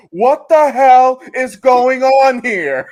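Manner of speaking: high, slow, loud hammed-up shout of disbelief; mock outraged yell. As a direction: bellow it out like a stunned villain. Quoting the movie's reaction.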